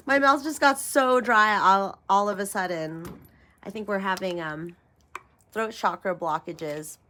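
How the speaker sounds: ordinary talk, nothing marked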